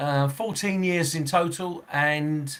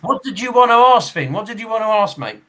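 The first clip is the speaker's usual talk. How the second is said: loud, higher-pitched call to a mate; raised-voice matey question; 6 semitones higher, 9 dB louder than usual. Real, not acted. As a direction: call out the question loudly to get his attention. Calling out to chat participant Finn.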